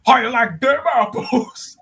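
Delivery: loud, high put-on cartoon voice; cartoon character shout. Fan quoting a favorite cartoon moment, loud high imitation.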